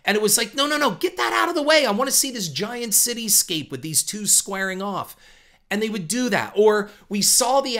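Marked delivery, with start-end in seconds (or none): 0.5-5.2 high, rushed burst of exasperation; frustrated viewer protesting in a high, hurried voice; impatient protest. Retold or imitated. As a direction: wave it away urgently, pitched well up. Impatient outburst at blocked movie shots.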